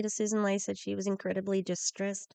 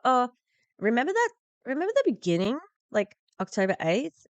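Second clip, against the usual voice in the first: much higher, louder disbelief; can you believe it, pitched way up; incredulous; 7 semitones higher, 6 dB louder than usual. Real, not acted. Commentator reminding viewers in disbelief, incredulous.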